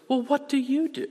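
high-pitched imitated woman; woman turning the question. Woman asking her seatmate in return, high and curious. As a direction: lift it right up as she asks him back. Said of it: "she turns the question".

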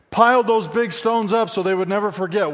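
loud, high, forceful preacher's call; emphatic preaching. Real, not acted. Preacher urging the congregation never to forget, in a loud, high voice. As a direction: drive the point home at full voice. Preacher stressing Israel's memorial stones.

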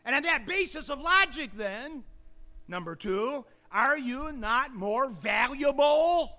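loud, high-pitched pulpit proclamation; full-force preaching. Real, not acted. Preacher pressing the congregation on God's care, full-force preaching. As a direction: drive the point home to the congregation.

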